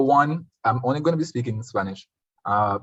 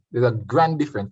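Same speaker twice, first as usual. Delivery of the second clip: usual speaking voice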